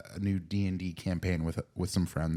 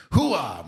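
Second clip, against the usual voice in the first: loud gung-ho soldier; 5 semitones higher, 5 dB louder than usual. Retold or imitated. Echoes the war cry.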